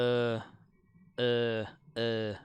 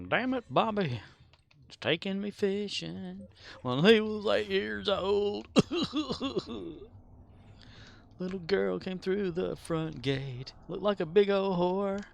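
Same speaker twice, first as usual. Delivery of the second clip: Hank Hill impression; 7 semitones higher than usual. Quoting Hank Hill lines.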